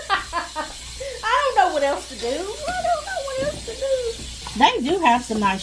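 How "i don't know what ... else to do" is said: laughing despair. Real, not acted. Joking despair about shoes.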